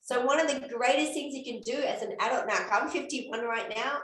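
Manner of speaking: usual speaking voice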